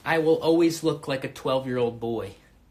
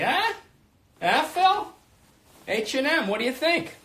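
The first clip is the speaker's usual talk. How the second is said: high-pitched mock homeless guy; 8 semitones higher than usual. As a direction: play a homeless man newly in money, voice pitched way up. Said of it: "I feel like a homeless guy".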